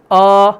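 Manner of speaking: rounded, heavy long آ; dark full-mouthed آ; thick alif. Shown as a demonstration. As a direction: fill the mouth on آ. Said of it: "پہلے والے الف کی آواز آ".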